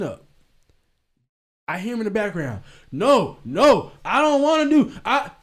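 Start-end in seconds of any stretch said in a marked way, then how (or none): 2.9-5.3 high, loud mock child whine; whining son. Son refusing in the background, mock childish whine.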